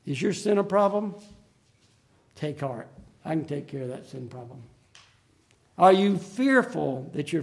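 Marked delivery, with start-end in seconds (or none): 2.4-4.6 soft comfort. Acted. Preacher voices Jesus's comfort to listeners.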